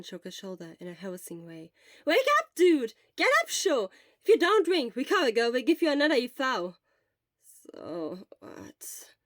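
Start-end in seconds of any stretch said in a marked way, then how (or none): 2.0-6.7 loud, high nagging wake-up call; urgent nagging. Acted. Friend loudly nagging Shota to wake and drink. 7.4-9.1 low, soft unvoiced grumble. A narrator's reading. Sleepy Shota grumbling softly at his nagging friend. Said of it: "he grumbled".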